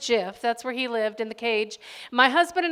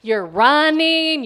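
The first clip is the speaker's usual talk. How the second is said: drawn-out scolding; 3 semitones higher, 10 dB louder, slower than usual. Retold or imitated. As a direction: stretch out "running" in disbelief. Mother confronting hamster at night.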